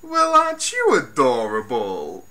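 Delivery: put-on loud, theatrical cooing at a child; mock-gush over the baby, hammed up; campy villain cooing. Reviewer imitating the villain gushing over the child. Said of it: "it's like".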